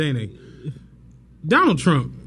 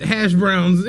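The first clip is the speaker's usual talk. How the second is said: usual speaking voice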